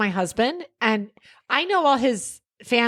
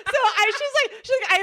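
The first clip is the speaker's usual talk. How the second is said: laughing; 11 semitones higher than usual. Real, not acted. Laughing mid-story.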